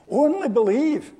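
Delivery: ONLY lifted high and loud; emphatic repeat. Shown as a demonstration. Preacher urging the congregation, emphatic and loud. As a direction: ring out Only believe with force. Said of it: "repeats with emphasis".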